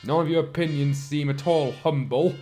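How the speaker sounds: usual speaking voice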